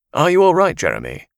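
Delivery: high, loud alarmed anchor; concerned newsreader. Acted. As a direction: ask it with sudden concern. Anchor checks on reporter.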